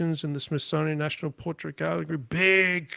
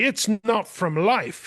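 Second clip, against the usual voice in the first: slow, higher mimicry of an objection; mock objection; 6 semitones higher, 3 dB louder, slower than usual. Gallery director dismissing a painter's work, mildly mocked objection. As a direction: draw it out, pitched up, imitating her.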